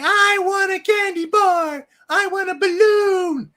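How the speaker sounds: put-on high, slow, loud whiny kid wail; bratty child screaming. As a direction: shriek it like a spoiled child demanding candy.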